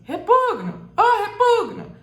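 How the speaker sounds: grito alto e agudo de chamado; chamando alguém. Shown as a demonstration. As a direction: chame pelo apelido, esticando o U tônico. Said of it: "como se você estivesse xingando a pessoa ou chamando". (chamando alguém aos gritos)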